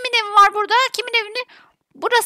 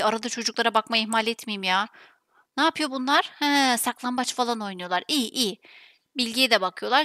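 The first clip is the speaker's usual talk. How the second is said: low, soft self-talk while busy; muttering to herself; 9 semitones lower, 7 dB quieter than usual. Acted. Mother tidying up after the children, muttering. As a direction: keep it under your breath, much lower. Reviewing chores alone, under her breath.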